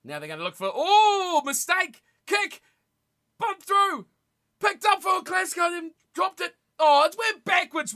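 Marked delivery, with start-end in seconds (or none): 0.7-6.5 excited shout. Real, not acted. Sudden scramble in live match. 6.8-7.9 high, loud cry of protest at the ref; surprised protest. Real, not acted. Watching the rugby, protesting a pass to the referee.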